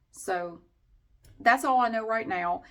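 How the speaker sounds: ordinary delivery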